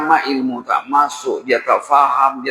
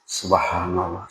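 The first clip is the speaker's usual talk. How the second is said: awed murmur; 6 semitones lower, 7 dB quieter, slower than usual. Acted. Preacher sighs in awe.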